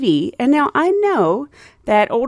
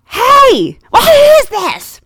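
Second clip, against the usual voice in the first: very high, loud, drawn-out mimicked call; hollering old lady; 7 dB louder, much slower than usual. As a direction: holler down the aisle as a demanding passenger.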